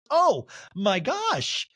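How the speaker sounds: high, long-drawn mock shock; Monica impression. Castmate reacting to an insult, slow mock shock. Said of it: "Monica goes".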